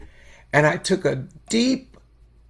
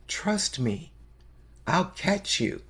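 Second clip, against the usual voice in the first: softer, gentle and hushed; tender reassurance; 7 dB quieter, slower than usual. Retold or imitated. Great Spirit reassures all of us, softly and tenderly. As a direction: whisper it as a divine promise. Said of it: "the Great Spirit is always saying".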